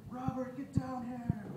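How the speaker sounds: high, drawn-out holler for a husband; mock a pissed-off mom calling out, stretching the words; imitated yelling. Mom calling dad downstairs about their drunk son, high slow yell. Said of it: "she starts yelling".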